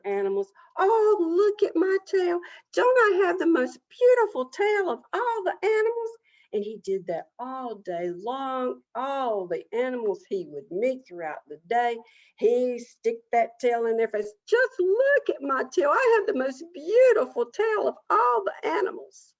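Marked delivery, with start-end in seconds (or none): 0.8-6.2 high, loud show-off animal voice; boastful Possum. Acted. Boastful Possum shows off tail. 14.5-19.3 high, loud show-off animal voice; boastful Possum. Acted. Boastful Possum shows off tail.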